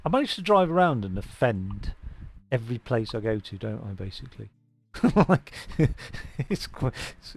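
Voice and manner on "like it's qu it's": giggling, breathless words; laughing. Real, not acted. Gamer laughing about offending every place, giggling. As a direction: laugh it out under your breath.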